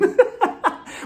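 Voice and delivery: high, breathy burst of laughter; laughing. Real, not acted. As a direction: laugh at your own story. Laughs at her own retelling.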